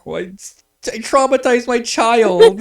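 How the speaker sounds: put-on mom wailing over her traumatized child; upset mom. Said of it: "my mom got so upset".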